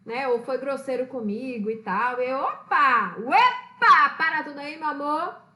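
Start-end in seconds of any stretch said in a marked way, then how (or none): 2.4-5.4 sassy mock rebuke. Reacting to rude person.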